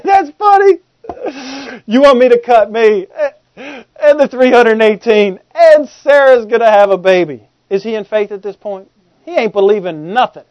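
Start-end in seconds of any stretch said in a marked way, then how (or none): 0.0-7.5 incredulous laughter breaking into the words; acted laughing. Abraham hearing Sarah will have a baby, laughing in disbelief. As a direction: laugh through it, loud and drawn out. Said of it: "fell on his face and laughed".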